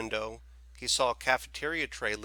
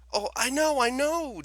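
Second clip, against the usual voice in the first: much higher, louder, wailing; boy admitting fault through tears; woeful admission; 7 dB louder than usual. Acted. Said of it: "John admitted woefully".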